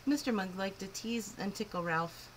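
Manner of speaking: ordinary delivery, nothing marked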